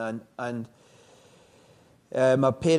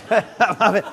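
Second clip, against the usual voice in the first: higher, louder, giggling through the story; can barely get it out for laughing; 9 semitones higher, 14 dB louder than usual. Real, not acted. Telling the interviewer an embarrassing army story, laughing. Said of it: "laughing while retelling".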